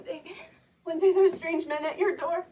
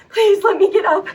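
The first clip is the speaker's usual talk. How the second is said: rising into a loud desperate plea, louder; 11 dB louder than usual. Acted. Woman begging the light to let her rise, louder. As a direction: push the plea harder the second time.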